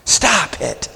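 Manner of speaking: squirm with snobbish embarrassment; mock embarrassed Pharisee. Pharisee watching the woman, slow prissy disdain. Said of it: "he's going".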